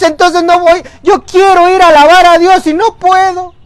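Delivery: Pedro pleading. Acted. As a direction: beg like a man trapped by his vice. Pedro begs to be washed. (Pedro suplicando, agudo y fuerte, desesperado)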